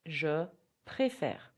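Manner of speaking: slow, careful French model; teacher modelling a phrase; slow repeat-after-me. Shown as a demonstration. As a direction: stretch it out for learners to copy. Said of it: "You can repeat after me".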